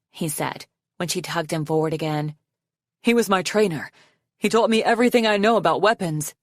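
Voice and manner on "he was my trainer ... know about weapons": put-on quick, earnest young prince; young male dragon voice. A narrator's reading. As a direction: voice the prince, a bit rushed. Arctic recalls his trainer.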